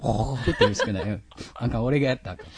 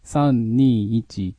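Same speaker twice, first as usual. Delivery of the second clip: normal talking voice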